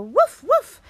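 high, loud woofs; playful dog bark. Pretend. Doggy song chorus, high playful barking. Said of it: "Can I hear a woof".

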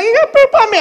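put-on high-pitched little-girl protest; high mock-tantrum kid voice; granddaughter's accusation. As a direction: imitate a fussy child blaming Grandpa. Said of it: "she's like".

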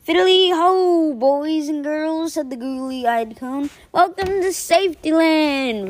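high, loud, slow theme-park greeter; cheery mascot. Acted. Safety cone mascot welcoming the students, cheery. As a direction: greet the kids like a beaming mascot.

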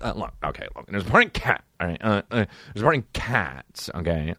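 lightly imitated manager pitching a part; low, halting phone pitch with a punched "Cats"; Josh. Manager calls his client about a part, lightly imitated. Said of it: "the way Josh says Cats".

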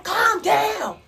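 high, loud retold yelling; mock outraged bellow, pitch shooting up; aggressive sergeant. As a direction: imitate an aggressive sergeant shouting someone down. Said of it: "he came over there too aggressive".

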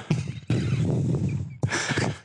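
short airy laugh; unvoiced chuckle at own joke; breathy laugh. Real, not acted.

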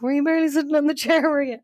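high, rushed, incredulous question; rapid questioning. Retold or imitated. Friend to her on stage, rushed high-pitched questioning. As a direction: fire it off fast and high.

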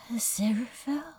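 a barely dared, breathless whisper of a name. A narrator's reading. Crowley to the angel, hardly daring to speak: whisper. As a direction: whisper it as if afraid to break the moment. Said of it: "He hardly dared to whisper the name".

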